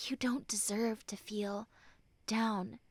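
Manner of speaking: breathy whisper of reassurance. Acted. Friend whispering comfort to a hurting listener. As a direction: whisper it close, like a secret.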